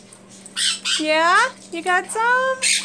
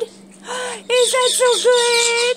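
excited gasp; 7 semitones higher, 3 dB louder, much slower than usual. Real, not acted. Owner gushing to pet over popcorn, loud high delight.

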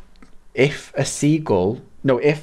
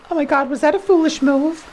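sharp gasp, then higher alarmed question; shocked gasp. Real, not acted. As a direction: gasp as the move goes wrong. Shocked at game move.

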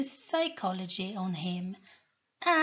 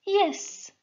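a bright, higher single word; curt high yes; 12 semitones higher than usual. A narrator's reading. Sol calmly answering the soldier's challenge, high. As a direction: answer him flatly, unbothered. Sol's simple defiant answer.

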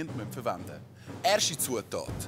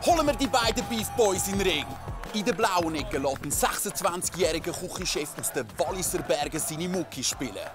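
boxing ring announcer; 5 semitones higher than usual. Acted. Host introduces fighters in ring.

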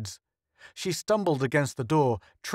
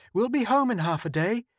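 lifted, higher hopeful voice; 8 semitones higher than usual. A narrator's reading. Joe reassuring the crew they'll be home, hopeful. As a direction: reach for hope as you promise home. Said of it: "Joe said hopefully".

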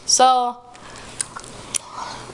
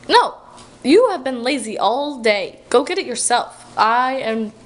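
usual speaking voice

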